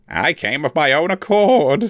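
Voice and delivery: small frightened creature pleading; Forficule voice. A narrator's reading. The captive elf lies to his torturer, high and timid.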